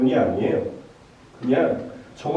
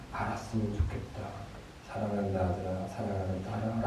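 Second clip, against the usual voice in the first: loving American mother; 2 semitones lower, 7 dB quieter than usual. Acted. American mom telling her hurt child she loves them, soft and tender. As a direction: speak gently, dropping to a hush by the end. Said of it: "미국의 엄마들은 이렇게 얘기한대요".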